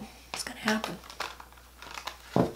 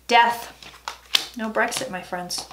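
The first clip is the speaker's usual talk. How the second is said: ordinary talk, nothing marked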